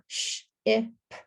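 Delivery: "sh, I, p" with a gap after each sound; hushed "sh", short "I", popped "p". Shown as a demonstration. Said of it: "And now we have".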